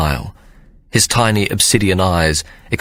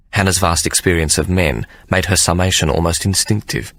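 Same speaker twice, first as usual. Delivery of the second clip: ordinary delivery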